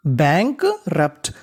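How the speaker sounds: very slowly sound out bankrupt; slow demo reading. Shown as a demonstration. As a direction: stretch bankrupt syllable by syllable. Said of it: "तो इतनी meaning बनी".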